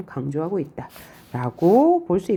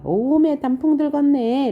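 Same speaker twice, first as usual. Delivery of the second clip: joyful poet; 8 semitones higher, 3 dB louder than usual. Acted. Poet urging sister to enjoy autumn, joyful exclamation. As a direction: marvel lightly at autumn, pitched well up. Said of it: "이 화자는 지금 즐거운 감정이에요".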